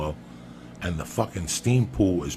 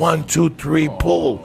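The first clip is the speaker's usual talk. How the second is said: put-on kids counting out loud; 7 semitones higher, 7 dB louder, much slower than usual. Kids counting to pull him out.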